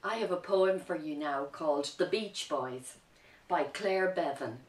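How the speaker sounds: ordinary talk, nothing marked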